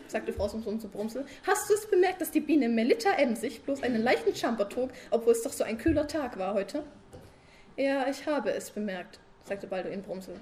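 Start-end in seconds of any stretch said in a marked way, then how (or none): 1.5-6.8 high, loud wife pestering her sleepy husband; nagging wife. Acted. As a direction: keep needling him with a raised voice. Frau Sumsum als Figur gesprochen. (hohe, laute nörgelnde Ehefrau)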